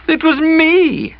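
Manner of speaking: very slow, high, loud admission; tearful confession. A narrator's reading. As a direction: confess it through tears.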